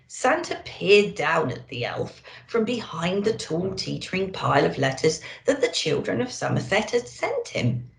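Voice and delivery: ordinary delivery, nothing marked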